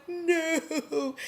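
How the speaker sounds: high, pained cry of no. Retold or imitated. Recapping a TV stabbing, she cries a high no. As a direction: protest at a stabbing on screen. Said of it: "And I'm like".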